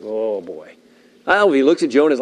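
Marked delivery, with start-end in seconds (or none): 0.0-0.7 low, soft, groaning fish voice. Pretend. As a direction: play the bloated fish, sighing low and soft. Fish voiced in retold Jonah story.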